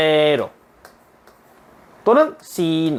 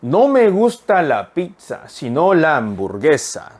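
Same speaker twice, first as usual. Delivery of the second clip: very slow, careful Spanish sentence; slow teacher read-aloud; slow model reading; much slower than usual. Shown as a demonstration. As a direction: read it slowly so students can follow. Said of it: "한 번 더요".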